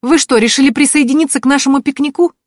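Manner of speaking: high, loud taunting question; snide. A narrator's reading. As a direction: needle the intruder with mock politeness. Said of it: "не удержалась я от ехидства".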